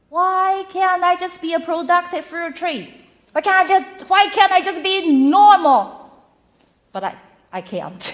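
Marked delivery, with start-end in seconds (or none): 0.1-6.1 higher, louder frustrated plea; building to a loud, pleading outburst; exasperated self-questioning. Retold or imitated. Speaker recounting questions she asked herself, exasperated. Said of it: "I have asked myself so many times".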